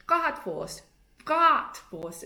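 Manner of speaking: "Bratwurst" with the R swallowed in the back of the throat; "Bratwurst" with a throaty swallowed R; swallowed German R. Shown as a demonstration. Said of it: "swallow your R's".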